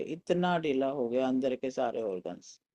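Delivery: ordinary talk, nothing marked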